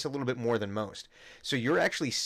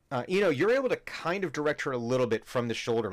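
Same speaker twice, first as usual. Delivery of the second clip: ordinary talk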